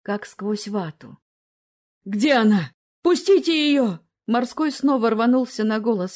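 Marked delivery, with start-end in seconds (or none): 2.0-4.0 desperate shout. A narrator's reading. Viktor struggles toward sister.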